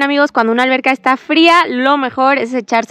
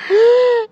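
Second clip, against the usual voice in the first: cold water gasp; 12 semitones higher than usual. Real, not acted. Jumping into cold pool water.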